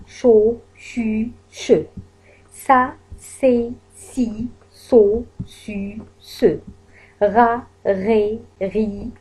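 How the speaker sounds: شا شي شو then سا سي سو and غا غي غو, slowly sounded; syllable drill. Shown as a demonstration. Teacher drilling ch, s and g syllables for the class. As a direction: sound out each syllable for the learners. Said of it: "هنا ينطق أش".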